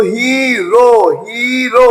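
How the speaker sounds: "हीरो" split as hee, ro; syllable by syllable. Shown as a demonstration. Teacher showing a two-sound word, "हीरो" in two long syllables. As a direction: draw out both halves of "हीरो", long ee first. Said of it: "एक sound मुकम्मल हो रही है".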